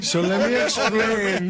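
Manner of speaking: loud, slow, high pilot announcement; helicopter pilot voice. Pretend. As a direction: announce it like a chopper pilot over the radio. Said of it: "in my best helicopter pilot voice".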